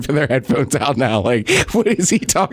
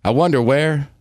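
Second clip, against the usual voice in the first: slow, solemn poem reading; poem recital; 4 semitones lower, slower than usual. Pretend. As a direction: recite it slowly like a poet. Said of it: "This is a poem".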